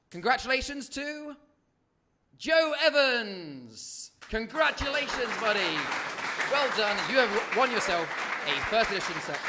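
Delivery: loud suspenseful reveal, name stretched; milk the suspense, then cheer; hyped giveaway announcement. Real, not acted. Top prize reveal.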